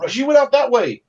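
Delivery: high, strained wounded voice; pained marshal. Acted. Wounded marshal pointing Russet to the window, high. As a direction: whine and point toward the window. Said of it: "he's going".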